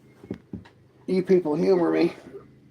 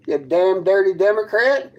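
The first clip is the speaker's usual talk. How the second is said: ordinary talk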